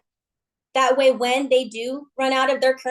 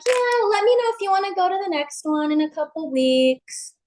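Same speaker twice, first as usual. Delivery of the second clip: casual friend; 5 semitones higher than usual. Retold or imitated. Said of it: "she's like".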